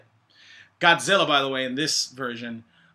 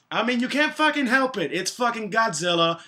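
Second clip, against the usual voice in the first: excited; 8 semitones higher than usual. Real, not acted. Gushing about Godzilla appearing.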